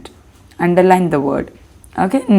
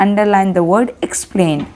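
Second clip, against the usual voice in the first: usual speaking voice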